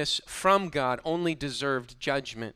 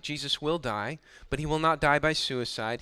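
usual speaking voice

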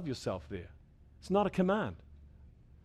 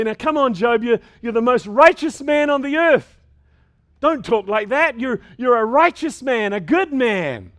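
a higher, louder pep talk, slowing on good man; mock comforting Lord; 8 semitones higher, 10 dB louder than usual. Preacher imagining the Lord comforting Job, loud hearty pep talk. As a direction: cheer him up like a hearty friend. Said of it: "comfort him and say".

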